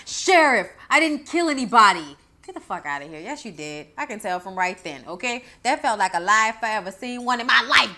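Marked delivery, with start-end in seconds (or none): 0.1-2.2 louder, overacted plea; mock Academy Award performance; mock Billy. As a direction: ham up a fake innocent plea. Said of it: "talking about".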